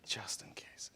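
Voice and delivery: whispered repeat, breathless and secret. Acted.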